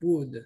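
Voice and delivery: wood with no L, like timber; silent L. Shown as a demonstration. Teacher modelling would for students, said as wood. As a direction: drop the L, just wood. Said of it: "por eso suena como madera".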